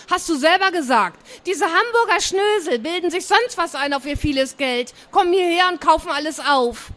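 high, worked-up grumbling about others; indignant retort. Acted. Niece reminds aunt what she said, indignant retort. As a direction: throw the complaint back, pitched high and indignant. Niece quotes aunt's complaint in quarrel.

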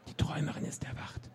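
hushed, awed whisper; soft voiceless whisper. A narrator's reading. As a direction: pass it on as a secret whisper. Whispered words passed on by crowd.

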